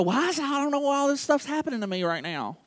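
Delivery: soft, stretched-out complainer's voice; hammed-up self-pitying moan; mock whining. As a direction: whine it like a put-upon believer. Mimics people complaining to God.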